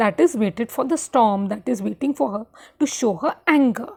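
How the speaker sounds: ordinary talk, nothing marked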